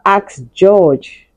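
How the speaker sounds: ordinary delivery, nothing marked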